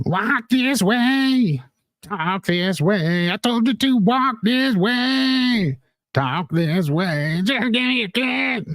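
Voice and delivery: high, slow put-on puppet voice; sing-song high character voice, slow; Mini Streets voice. Streamer doing a tiny character for chat, high and slow. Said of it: "it's Mini Streets".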